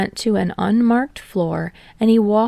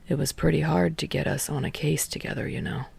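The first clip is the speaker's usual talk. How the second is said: drawled; 5 semitones lower, 8 dB quieter, faster than usual. Acted. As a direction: toss it off lazily, trailing away. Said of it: "Shinso drawled out".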